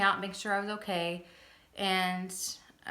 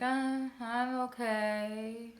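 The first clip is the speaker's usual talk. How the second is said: slow, dreamy and drifting; woozy post-seizure drawl; spaced-out and floaty; loopy; much slower than usual. Retold or imitated. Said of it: "starting to get a little bit loopy".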